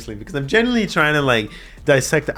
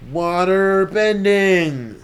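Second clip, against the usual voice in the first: slow, drawn-out, awed and higher; awed pronouncement; 6 semitones higher, 4 dB louder, much slower than usual. Real, not acted. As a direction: savor it like a big reveal. Awed at anime water bending.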